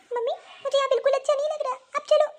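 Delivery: put-on high, tearful little girl complaining; crying child. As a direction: whimper it like a hurt child. Child sobs out complaint to mother.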